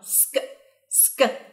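"سك" as a quiet s running into short k; sk sound demonstrated. Shown as a demonstration. Teacher recapping how S K is pronounced, isolated sk sound. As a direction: give a bare, soft sk for "سك". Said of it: "اللي تتنطق".

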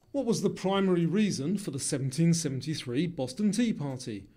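normal talking voice

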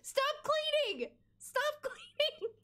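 high, drawn-out exasperated plea; exasperated urging. Real, not acted. Urging game character to stop chores.

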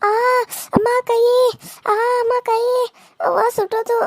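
high, whimpering put-on cry of pain; fake crying over hand. Wife wails to draw the husband, high fake crying. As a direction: whine as if the hand just got burnt.

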